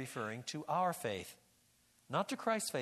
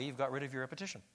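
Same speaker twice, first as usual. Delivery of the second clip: ordinary talk, nothing marked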